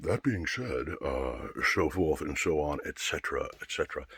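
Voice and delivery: mock-formal, trailing off quietly; mocking stock phrase. Shown as a demonstration. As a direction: make fun of the cliché in a put-on voice. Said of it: "I hate when people say that".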